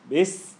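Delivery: "this" said as "bis", ending in a long hiss; short b, then sustain the s in "this"; held s. Shown as a demonstration.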